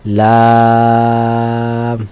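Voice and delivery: লাম held long on one even note; tongue tip to upper gum, then stretch লাম; drawn-out letter name. Shown as a demonstration. Teacher shows where লাম is articulated, drawn out. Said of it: "কি বলতে হবে?".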